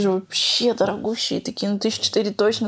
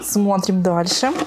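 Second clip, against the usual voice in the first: ordinary talk, nothing marked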